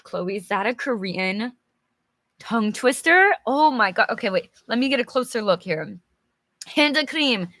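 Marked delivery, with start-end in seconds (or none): none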